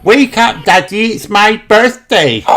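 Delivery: high, loud little pig shout; Peppa shouting. A narrator's reading. Peppa waking her parents on her birthday, shouting. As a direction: wake Daddy up with a squealing shout. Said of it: "Peppa shouted".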